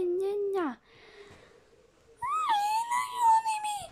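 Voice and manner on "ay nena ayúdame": shrill, much higher hammed-up plea for help, half shouting; mock cry for help. Player mock-pleading to the game for help, in a shrill cry. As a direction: wail for help like a helpless little girl.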